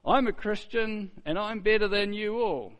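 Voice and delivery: high, loud smug bragging voice. Retold or imitated. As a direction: sneer it like a proud show-off believer.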